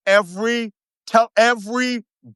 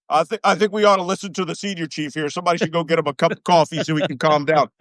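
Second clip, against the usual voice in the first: lower, fast, dismissive officer voice; mocking officers; 6 semitones lower, much faster than usual. Officers brushing off the angry chief, lower and fast. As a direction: toss it off low and quick like a smug brass.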